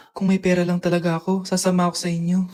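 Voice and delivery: low, soft sulky mutter to himself; bitter aside. Acted. Left-out kid muttering about having no money, low and soft. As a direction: grumble it under your breath.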